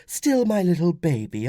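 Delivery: high woman's voice, cooing protest; doting mother. Acted. Mother to her small son, doting. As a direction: coo it over a little child. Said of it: "Flavia protested".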